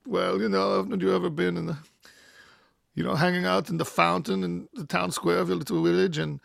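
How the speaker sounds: put-on German accent, dropping to a whisper; casual German storyteller, ending in a whisper. Frieder explaining his scars in a German accent. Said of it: "these German accents are great".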